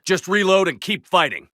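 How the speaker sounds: high battle order; shouting. Acted. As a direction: call it out to the squad.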